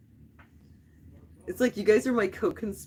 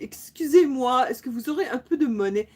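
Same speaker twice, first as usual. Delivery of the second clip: begging in a put-on Parisian French accent; 5 semitones higher, 5 dB louder than usual. Pretend panhandler asking passersby for change, in a Parisian accent. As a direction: ask for spare change in mock Parisian French. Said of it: "speak, like, with a Parisian accent".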